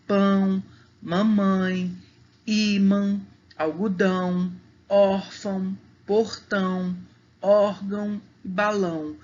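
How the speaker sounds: tilde vowels sent through the nose in "pão, mamãe, ímã, algodão, órfão, portão, órgão e balão"; evenly spaced "pão, mamãe, ímã, algodão, órfão, portão, órgão e balão", nasalising each ã; nasal vowels. Shown as a demonstration. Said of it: "a palavra tem um som nasal".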